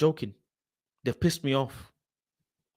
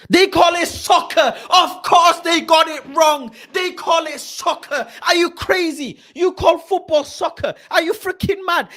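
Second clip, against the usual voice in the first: high, loud outraged yelling; shouting at the screen in outrage; furious rant; 12 semitones higher, 9 dB louder than usual. Real, not acted. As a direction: blast them in disbelief. Ranting at Transfer Market's CEO.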